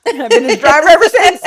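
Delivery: loud, high laughing line. Real, not acted. Telling the listener the punchline, laughing. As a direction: laugh through the words.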